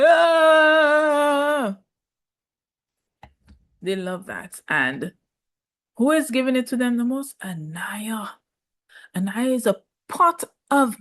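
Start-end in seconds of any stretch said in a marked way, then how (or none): 0.1-1.8 mock cry of pain. 7.4-8.3 whispered name. Real, not acted. Dramatic reveal of the name.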